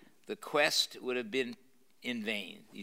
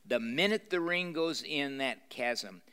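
plain reading voice

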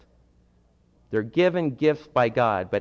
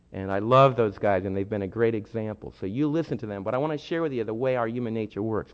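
ordinary talk, nothing marked

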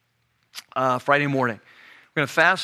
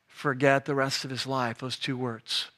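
hushed; 3 semitones lower, 7 dB quieter than usual. Shown as a demonstration.

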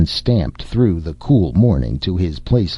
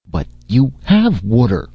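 confused guard, a bit higher and baffled; puzzled; 5 semitones higher than usual. Acted. Narsisi puzzled that Jason wants more water, baffled. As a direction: sound honestly puzzled by the request. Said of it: "Narsisi said, puzzled".

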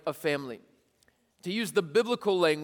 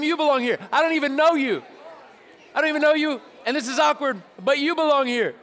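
higher and louder, playful and excited; hyped-up example line; 8 semitones higher, 6 dB louder than usual. Shown as a demonstration. Pastor joking with students about greeting strangers, excited and loud. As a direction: pump it up like a goofy hype man. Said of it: "I want you to tell them".

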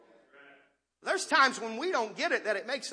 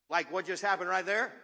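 ordinary delivery

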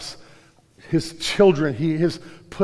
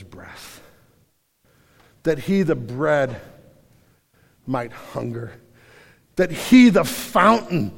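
solemn reading; 7 dB louder than usual. Acted. Preacher reading Augustine to the congregation, slow and solemn. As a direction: read it like an ancient creed.

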